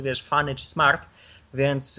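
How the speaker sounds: ordinary talk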